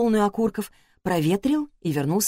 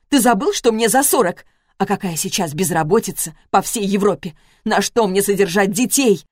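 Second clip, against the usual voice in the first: rising angry, louder and higher; bitter outburst; 3 semitones higher, 4 dB louder than usual. Acted. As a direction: hurl the questions at him.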